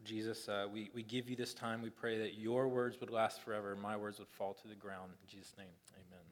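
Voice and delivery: soft, low, halting prayer; gentle spoken prayer, dropping away; pastoral prayer. Real, not acted. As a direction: pray quietly over the congregation, with pauses.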